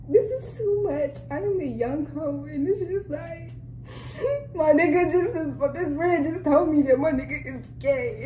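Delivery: half laughing, half sobbing, with sudden high breaks; overwhelmed, laughing through mock tears; laugh-crying. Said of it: "I just want to shed a fucking tear".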